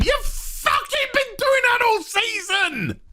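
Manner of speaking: high, cracking yell of disbelief; exasperated yell. Real, not acted. Reviewer yelling at the writers about the repeated plot, high exasperated yell. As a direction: yell at the screen, fed up.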